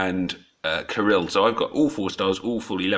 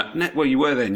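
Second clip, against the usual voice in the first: ordinary talk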